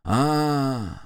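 puzzled, drawn-out "ah". A narrator's reading. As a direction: let the puzzlement stretch out. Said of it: "озадаченно молвил он". (озадаченно)

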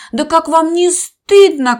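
high, loud, scolding shout; little bird scolds the crowd, loud indignant cry; ringing indignant cry. Acted. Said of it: "крикнула она своим звонким голоском".

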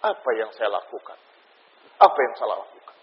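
low, soft imitation of remorse; quiet regret. Preacher quoting someone after anger subsides, subdued regret. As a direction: voice a remorseful man, hushed and low. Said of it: "berkata dengan kalimat penyesalan".

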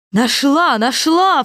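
high, loud happy cry; excited cry. A narrator's reading. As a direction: cry out with joy at finding it. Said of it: "вскричала волшебница".

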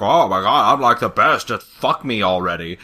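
loud, bragging show-off voice; Trixie. Acted. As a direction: gush about yourself, hammed up. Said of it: "Trixie is just like".

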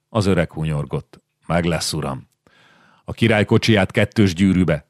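usual speaking voice

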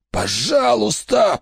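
high, slow, breaking plea; desperate pleading cry. A narrator's reading. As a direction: beg for your life. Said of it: "прошептал Брэк".

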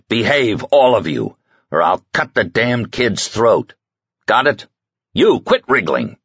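lightly voiced tense captor barking orders; threatening kidnapper. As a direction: snarl the threat through a hostage grab. Hans threatens the hostages.

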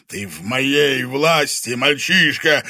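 slow, loud monster roar; growl. A narrator's reading. Convulsing dragon claiming the boy, loud growl. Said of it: "прорычал он, корчась в судорогах".

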